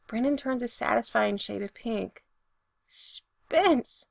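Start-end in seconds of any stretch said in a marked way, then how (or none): 2.9-4.1 high, squeaky cry of the name; flustered squeak. A narrator's reading. Brendan reacting to Spencer's love confession, squeaking. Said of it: "he actually squeaks".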